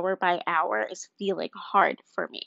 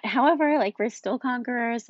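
upbeat; 4 semitones higher than usual. Real, not acted. Sudden upbeat turn.

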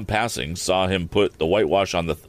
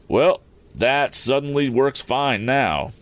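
higher, drawn-out happy exclamation; pleased cry; 6 semitones higher, slower than usual. A narrator's reading. Handyman delighted with the inventor's fix, crying out. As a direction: slowly marvel at how well it works. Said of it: "cried the man".

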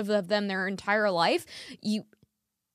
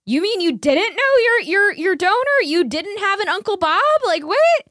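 much higher and louder, incredulous; shocked disbelief; 10 semitones higher, 10 dB louder than usual. Retold or imitated. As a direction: shriek out the disbelief as if it's unthinkable. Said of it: "They're like".

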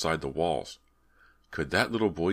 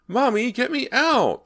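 put-on very high, loud, drawn-out child's screech; trapped child's piercing scream; screeching little boy; 9 dB louder, slower than usual. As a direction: screech for rescue like a panicked small boy. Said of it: "the boy screeched".

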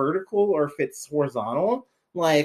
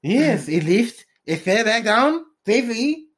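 mock French accent; 4 semitones higher than usual. Answering as French character.

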